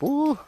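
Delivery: high, short "ooh" of relief; retold puff of a too-full walker; high-pitched "ooh". Recalling the walk after a heavy meal, high "ooh". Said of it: "I was like".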